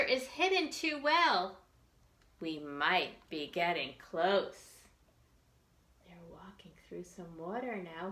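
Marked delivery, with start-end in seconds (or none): 6.1-8.1 soft whispered aside. Real, not acted. Teacher describing the picture in a whisper. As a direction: whisper it to the listeners.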